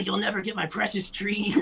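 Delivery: high, soft, possessive voice; mock greedy hoarder. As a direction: play a Gollum-like miser clutching his tree. Mocking the hoarding researcher.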